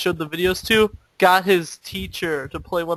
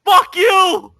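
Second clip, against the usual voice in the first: much higher, loud outburst; angry shout; 13 semitones higher, 10 dB louder, much slower than usual. Retold or imitated. Fake cop answering teasing classmates, shouting angrily. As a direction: yell it back at the hecklers, hammed-up.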